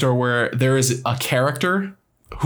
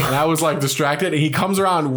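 usual speaking voice

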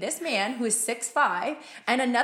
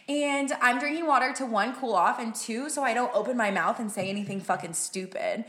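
ordinary talk, nothing marked

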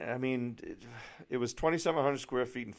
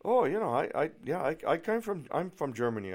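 higher-pitched imitation of a German accent; German realtor; 7 semitones higher, 3 dB louder than usual. German realtor saying where she comes from, imitated accent. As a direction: mimic a chatty German woman, pitched up. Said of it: "tell by the accent she's obviously from Germany".

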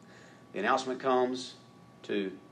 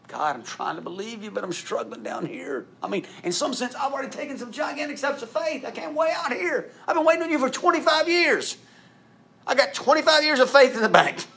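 high, loud exasperated Abraham; exasperated old Abraham pleading, with a laugh; Abraham complaining; 8 dB louder than usual. Acted. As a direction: vent at God as worn-out Abraham. Abraham voiced complaining to God.

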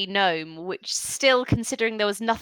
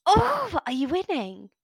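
lightly voiced child; 4 semitones higher than usual. GM voicing orc child.

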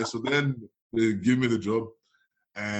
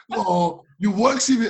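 loud, high, punchy correction; exasperated mock objection; 9 semitones higher, 7 dB louder than usual. Friend demanding a work CV, in loud exasperation. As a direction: act out an exasperated friend raising his voice. Acted argument over the CV.

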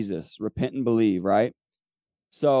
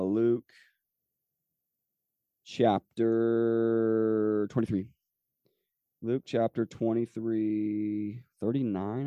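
slow, stretched words while looking up; absent, drawn-out reference reading; searching aloud; much slower than usual. Real, not acted. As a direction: draw out the words as you hunt for the page. Finding the chapter aloud.

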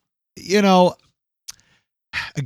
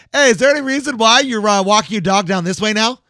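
mock neighbor; 6 semitones higher, 5 dB louder than usual. Neighbor confronting him, retold.

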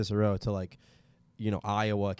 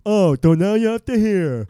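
indignant gangster; 9 semitones higher, 11 dB louder than usual. Acted. Gangster bit turns to hearing joke.

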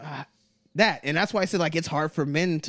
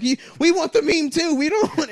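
much higher, louder whiny demand; mock whine; 13 semitones higher, 6 dB louder than usual. Mimicking people claiming the Pepe meme in a shrill whine. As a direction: squeal it like a greedy child.

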